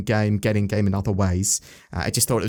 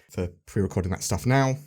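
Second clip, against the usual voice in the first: ordinary talk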